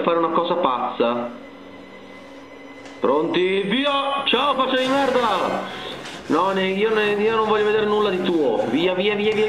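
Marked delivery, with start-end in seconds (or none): 3.6-5.8 mocking taunt. Real, not acted. Provoca il mostro nel gioco.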